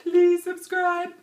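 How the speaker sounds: high, slow, loud sing-song plea; mock pleading. Asking viewers to subscribe, a high drawn-out plea. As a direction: beg them sweetly, stretching it out.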